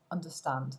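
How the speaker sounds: understand with STAND stressed, as it should be; correctly stressed underSTAND; stress on last syllable. Shown as a demonstration. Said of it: "Il est sur la troisième syllabe".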